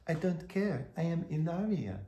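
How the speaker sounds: imitating Hilaria's accented English, high and soft. Host mimicking Hilaria insisting on her name, in a mock accent. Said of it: "if I do a Hilaria accent".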